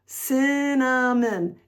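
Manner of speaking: "cinnamon" stretched slowly, each syllable held. Shown as a demonstration. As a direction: draw "cinnamon" out at half speed. Drawing out the word syllable by syllable.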